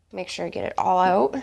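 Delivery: usual speaking voice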